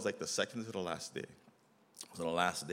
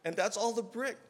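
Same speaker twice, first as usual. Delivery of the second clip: high, excited, laughing a little; amazed; 13 semitones higher, 3 dB louder than usual. Retold or imitated. Describing the huge brick stack, high amazed. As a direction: paint the pile as absurd, pitch up.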